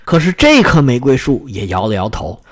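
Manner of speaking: plain reading voice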